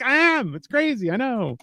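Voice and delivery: high, soft reenacted reply; mock sheepish admission. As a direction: admit it small and high. Acted reply to disbelieving Greeks.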